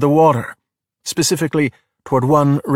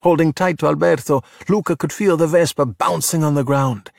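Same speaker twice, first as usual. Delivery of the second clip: ordinary delivery, nothing marked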